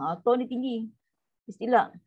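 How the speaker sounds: usual speaking voice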